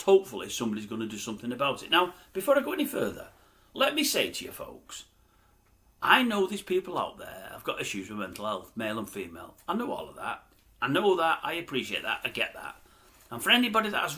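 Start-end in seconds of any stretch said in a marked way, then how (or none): none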